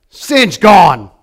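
ordinary delivery

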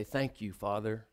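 very soft, low thank you; soft whispered thanks; hushed prayer. Acted. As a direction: barely voice it, reverent. Jesus praying quietly.

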